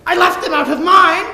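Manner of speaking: mock innocence. Mercutio's mock innocent reply.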